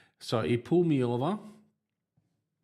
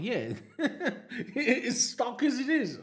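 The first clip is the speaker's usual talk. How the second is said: laughing; 13 semitones higher, much slower than usual. Retold or imitated. Laughing reply to officer.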